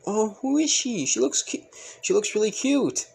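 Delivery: high-pitched cooing compliment; acted delight, pitched well up. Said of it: "Pretty much complimenting Toga".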